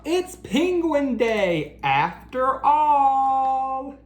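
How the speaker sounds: slow, high, delighted cry; crying out with joy. Acted. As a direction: call it out like the best news ever. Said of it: "Splat cried out".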